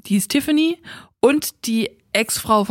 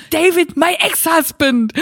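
slow, higher, louder, spelling it out; exasperated correction; 6 semitones higher, 4 dB louder, slower than usual. Retold or imitated. Angie insists she meant her ex-husband, slow and exasperated. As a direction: drag it out in frustration. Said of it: "völlig außer sich".

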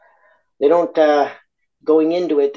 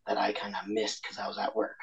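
whispered regret; 3 semitones lower, 10 dB quieter than usual. Real, not acted.

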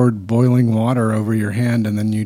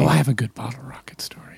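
hushed whispered aside; 6 dB quieter than usual. Real, not acted. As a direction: drop to a breathy whisper. Whispering aside during podcast.

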